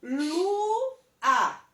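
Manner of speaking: Lua in two beats, LUU, pause, A; split into syllables. Shown as a demonstration. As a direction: break Lua after a long U. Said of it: "vou perceber que ela tem duas sílabas".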